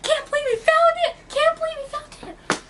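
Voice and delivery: very high squealed celebration; high, excited victory cry; giddy triumph. Retold or imitated. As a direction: squeal with retold disbelieving glee. Acted celebration after leaving.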